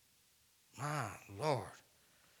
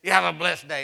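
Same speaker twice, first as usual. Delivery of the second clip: loud, curt mock blessing; insincere blessing; 2 semitones higher, 10 dB louder than usual. Solicitor leaving, a loud hollow have a blessed day. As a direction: bless them without meaning it. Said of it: "It didn't sound like it was really".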